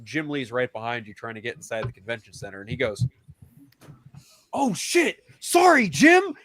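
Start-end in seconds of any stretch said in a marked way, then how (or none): 4.5-6.3 high, mock-flustered apology; imitated startled oops, much higher. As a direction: jump up high, imitating sudden realization. Acts stranger startled by Jim Lee.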